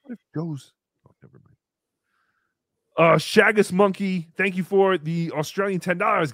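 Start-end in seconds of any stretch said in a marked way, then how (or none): none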